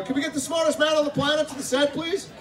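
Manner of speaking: high far-off voice calling out for him; mock film-set crew call. Crew on set summoning the actor, high mock call. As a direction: call across the set in a put-on voice. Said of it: "they go".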